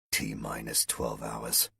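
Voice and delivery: low, slow, soft mumble; mumbling to himself. A narrator's reading. Colonel alone with the countdown, mumbling to himself. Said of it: "the colonel mumbled to himself".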